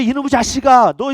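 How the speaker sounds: loud mock scolding of a naughty kid; mother's angry scolding. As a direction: bark it like a fed-up mother. Mother scolding children, retold.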